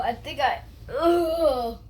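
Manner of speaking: pained groan near tears; strained groan. Real, not acted. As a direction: groan like it hurts. Straining, about to burp.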